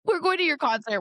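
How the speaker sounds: high, loud mock fan excitement; excited fan quote. Podcast host acting out fans cheering on Jesse, high excited shout. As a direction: call it out like an eager fan. Retelling fans' excited reaction to musician.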